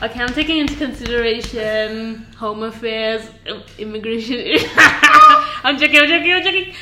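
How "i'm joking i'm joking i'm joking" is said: laughing it off, higher and louder; light laughing backpedal. Real, not acted. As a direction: giggle through I'm joking. Laughing after a joke.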